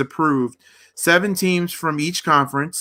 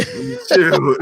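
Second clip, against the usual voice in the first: laughing squeal. Real, not acted. Laughs at the joke.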